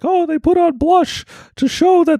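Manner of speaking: very high-pitched put-on intellectual voice; mock lecture in a high, strained impression; Jordan Peterson. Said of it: "Jordan Peterson when it comes to".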